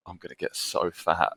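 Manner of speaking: said softly to oneself, retelling; quiet retold aside. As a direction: drop the volume for a quoted private thought. Retold worried thought to himself.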